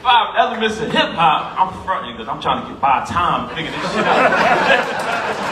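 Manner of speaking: laughing. Real, not acted. Laughs recalling hip-hop quiz.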